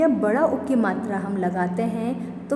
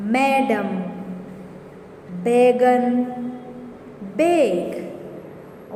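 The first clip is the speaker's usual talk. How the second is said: classroom word drill; much slower than usual. Shown as a demonstration. Teacher drills ऐ words.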